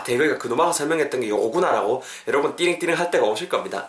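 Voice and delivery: normal talking voice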